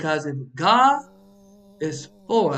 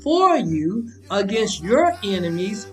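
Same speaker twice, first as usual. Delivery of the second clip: louder, higher, rising to a preacher's fervor; fiery preaching; 5 semitones higher, 3 dB louder than usual. Acted. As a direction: preach it to the congregation with fire. Preaching at full force.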